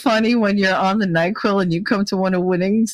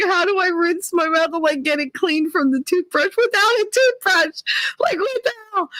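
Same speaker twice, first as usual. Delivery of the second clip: higher, whiny imitation of her panic; mock upset; 11 semitones higher than usual. Mimicking Kate, upset over her toothbrush, in a high whine. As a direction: play the grossed-out sister-in-law, lightly.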